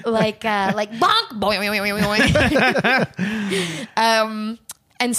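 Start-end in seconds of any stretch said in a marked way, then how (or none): 0.9-3.9 bouncy slapstick boing sounds; cartoon bonk noises. Shown as a demonstration. Joking about slapstick violence, bouncy cartoon boings. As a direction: make the boings springy like a cartoon. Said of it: "slapstick moments".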